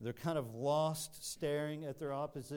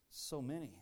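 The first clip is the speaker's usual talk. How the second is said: softer, defeated, almost to himself; weary dismay; 2 semitones lower, 7 dB quieter than usual. Retold or imitated. Weary soldier staring at the vast army, soft defeated voice. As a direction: let the count of the enemy sink in, softly. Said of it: "and we're going".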